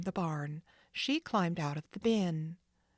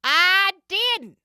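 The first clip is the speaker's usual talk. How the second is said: much higher, loud sharp denial; retort; 14 semitones higher, 14 dB louder than usual. Acted. Jake retorting to the third man, sharp. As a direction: snap back. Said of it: "the other retorted".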